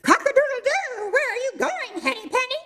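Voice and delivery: very high crowing rooster. Acted. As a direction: crow it out like Cocky Locky. Rooster voiced in fable.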